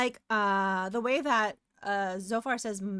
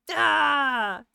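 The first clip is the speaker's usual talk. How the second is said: mock scream; 4 semitones higher, 9 dB louder than usual. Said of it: "He'd be like".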